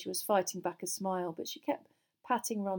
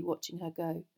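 ordinary delivery

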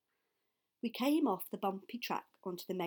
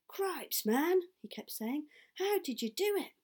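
amazed exclamation; 7 semitones higher, 4 dB louder than usual. Acted. Charlie amazed at the haul.

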